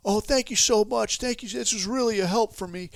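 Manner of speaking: high-pitched, gushing thanks; put-on high voice full of gratitude; mock grateful follower. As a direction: parody a gullible believer thanking the guru. Acted grateful follower answering the guru.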